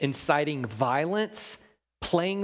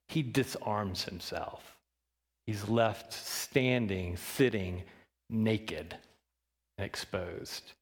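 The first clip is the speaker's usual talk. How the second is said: fading quiet; 5 semitones lower, 5 dB quieter, slower than usual. Acted. Sermon point fading.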